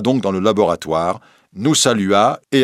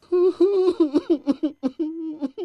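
high-pitched acted sobs; thin, high crying fit; childlike sobbing; 4 dB quieter than usual. A narrator's reading. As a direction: weep like a child, in high little bursts. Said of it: "se mit à pleurer comme un enfant".

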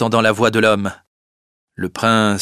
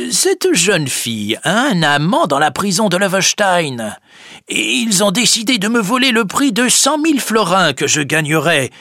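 higher, indignant Boxtel; Boxtel's accusation; 8 semitones higher than usual. Acted. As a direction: accuse the girl boldly.

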